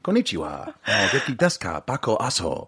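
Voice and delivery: mock Japanese greeting, lightly put on; improvised Japanese accent. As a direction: pretend to be a Japanese speaker.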